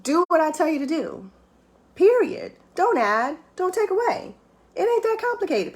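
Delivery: high-pitched, emphatic decree; blunt command. Retold or imitated. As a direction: lay it down as a simple order. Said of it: "his word literally says".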